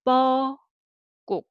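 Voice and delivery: high, loud 뻐꾹 with a long first syllable; Korean cuckoo call. Shown as a demonstration. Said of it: "you hear this way".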